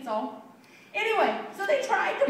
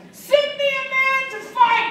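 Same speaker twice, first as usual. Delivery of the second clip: raised, higher mock challenge; Goliath yelling; 6 semitones higher, 4 dB louder than usual. Teacher acting the giant challenging an army, yelling. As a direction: call it out as a taunting giant. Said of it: "he's just yelling".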